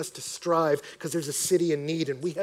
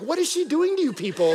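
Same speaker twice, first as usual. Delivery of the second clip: higher, louder, incredulous outburst; raised-voice comic protest; mock bafflement; 9 semitones higher, 6 dB louder than usual. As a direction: blurt it out in comic disbelief. Mock dismay at the speaker's wife or friend.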